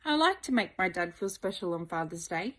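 ordinary talk

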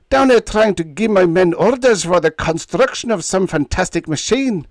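loud Scottish burr in high dudgeon; indignant Scottish burr, loud. A narrator's reading. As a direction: bristle with Scottish offence. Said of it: "in high dudgeon".

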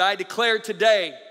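preacher declaring with full voice; forceful preaching. Acted. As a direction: proclaim it to the congregation.

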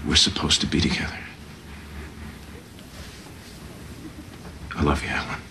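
soft whispered confession; whispering. Acted. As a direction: breathe it, barely voiced.

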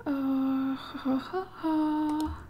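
soft nervous little laugh. Real, not acted. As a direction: laugh under your breath, uneasy. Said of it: "Game, you make me nervous".

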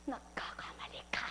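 sinister whisper; menacing whisper. A narrator's reading. Demon threatening the listener, whispered. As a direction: hiss the threat softly. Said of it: "Sabi niya".

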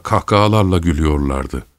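ordinary delivery

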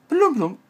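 high loud blubbing goldfish sound; goldfish blub noise. Pretend. Mimicking a goldfish for the viewers, loud high blub.

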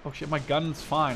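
ordinary delivery

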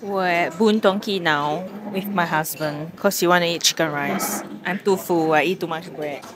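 normal talking voice

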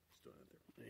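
low, hushed throwaway; whispered aside. Real, not acted. Tossing off a hushed aside to the viewers. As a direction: breathe it out as an aside.

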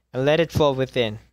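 ordinary delivery

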